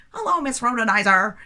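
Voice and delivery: high, loud goofy greeting; silly voice. Shown as a demonstration. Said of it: "in a silly voice".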